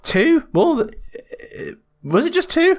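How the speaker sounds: very high-pitched, disbelieving query; incredulous. Real, not acted. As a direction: question it in surprise, voice leaping up. Disputes the count just given.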